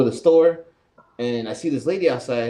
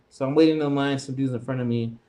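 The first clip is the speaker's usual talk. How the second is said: ordinary talk, nothing marked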